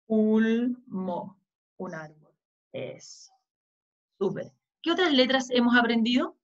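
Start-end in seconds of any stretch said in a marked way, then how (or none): 0.1-1.4 "Ulmo" in two parts, "ul" stretched, then "mo"; sounding out. Shown as a demonstration. Teacher reading together with children, "Ulmo" in slow syllables. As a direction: draw out "Ulmo" for reading along. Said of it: "Leamos todos juntos".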